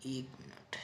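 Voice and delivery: hushed off-mic aside; whispered aside. Real, not acted. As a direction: whisper to yourself while fixing the board. Aside while arranging.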